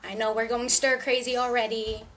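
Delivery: usual speaking voice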